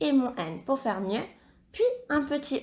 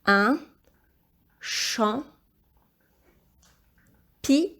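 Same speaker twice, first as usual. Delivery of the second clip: isolated syllables with long pauses; syllable-by-syllable dictation; 4 dB louder, much slower than usual. Shown as a demonstration. Teacher helping pupil spell champignon, syllable-by-syllable dictation. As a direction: break "Champ. Pi. Nion." into separate, measured beats.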